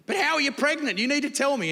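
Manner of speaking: high-pitched demand for an explanation; demanding questioning. Acted. Joseph confronting Mary about her pregnancy, high and demanding. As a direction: press for an answer, pitched well up.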